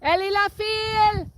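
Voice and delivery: high, loud drawn-out French call; high cow call. Real, not acted. Farmer calling her cows in French across the wind. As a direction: sing it out to the herd. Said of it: "Let's try it again".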